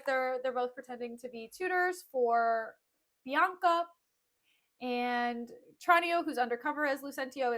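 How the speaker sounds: usual speaking voice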